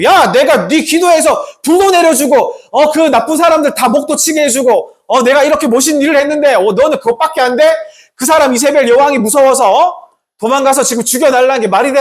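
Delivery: high-pitched, indignant telling-off; mock angry rebuke. Preacher imagining himself as God scolding Elijah, mock angry rebuke. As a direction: scold him as if fed up, playing it up. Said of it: "막 화를 냈을 것 같아요".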